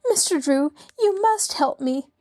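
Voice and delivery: lightly voiced distraught lady; a hint of a pleading society widow, high; anxious society lady. A narrator's reading.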